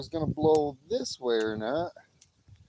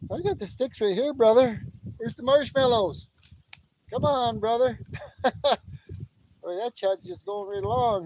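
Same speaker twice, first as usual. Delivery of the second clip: normal talking voice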